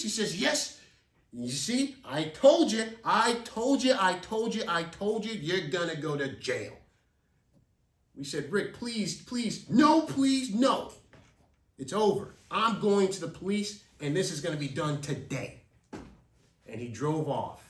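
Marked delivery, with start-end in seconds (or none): 0.4-6.8 loud, fading with each repeat; gloating yell. Retold or imitated. Rick gloating. 8.5-10.9 desperate begging. Retold or imitated. Kids begging Rick. 11.8-15.6 triumphant threat. Retold or imitated. Rick announcing police.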